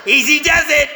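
high, loud mock-soothing through a struggle; mock strangling. Member fantasizes suffocating Nancy on the drive home, mock strangling. Mimed choking of Nancy, acted out.

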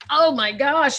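be dazzled by the dancers; oh my gosh. Retold or imitated. Stunned by the nightclub dancers.

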